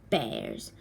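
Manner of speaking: plain reading voice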